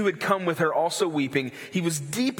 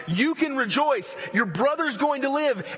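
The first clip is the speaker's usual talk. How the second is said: jubilant announcement; 10 semitones higher than usual. Retold or imitated. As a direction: call out the happy news to grieving friends. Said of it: "I'd want to just roll up and say".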